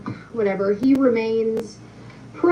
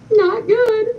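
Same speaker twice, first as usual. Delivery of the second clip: tearful; 11 semitones higher, 4 dB louder than usual. Retold or imitated. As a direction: voice breaking high into tears. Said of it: "then the waterworks come".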